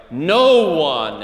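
'no one' said loud and punched; stressed quote. Shown as a demonstration. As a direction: hit 'no one' hard. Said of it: "the strong vocabulary of".

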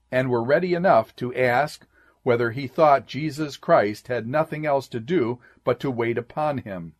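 ordinary talk

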